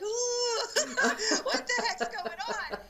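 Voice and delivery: very high squeal breaking into laughter; mock-spooked, laughing through the words; laughing mock fright. Laughs, mimicking her spooked reaction.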